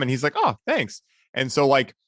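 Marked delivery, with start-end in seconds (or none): 0.4-1.0 put-on grateful kid. Sleepy kid thanks them.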